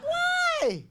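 high squealed mock-crying whine; squeaky, drawn-out cartoon lament; mock wail. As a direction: wail it way up high, comically dismayed. Acts out dismayed reaction to neighbor.

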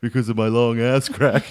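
sheepish retold apology; imitated chastened child; mock apology. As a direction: grovel to mum, hammed up. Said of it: "catch up and be like".

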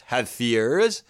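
"have fears" with "fears" stressed and drawn out; light "have", strong rising "fears"; iambic stress. Shown as a demonstration. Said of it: "unstressed, stressed".

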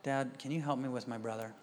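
low, soft, controlled request; calmed-down daughter. Retold or imitated. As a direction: imitate a child who has calmed herself to ask nicely. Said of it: "my daughter goes like this".

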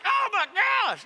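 sudden high, loud oh my gosh; shocked yelp. Retold or imitated. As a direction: yelp "Oh my gosh." as if the pain just hit. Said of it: "I was like".

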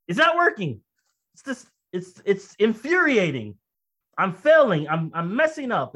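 acted-out exasperation, high-pitched; angry outburst at God. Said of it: "I'm cussing at God".

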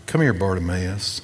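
soft, low, tender call; Jesus softly calling the blind beggar, low and gentle. Acted. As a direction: call him over kindly and quietly.

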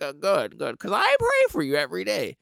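put-on pious old lady, mildly done; a hint of the old neighbor. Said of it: "she's like".

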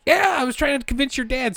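high put-on mom voice. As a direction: pitch up to play mom. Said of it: "she's like".

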